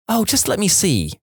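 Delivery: high, lightly coaxing mother voice; a hint of an impatient mum wanting to look. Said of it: "My mum was like".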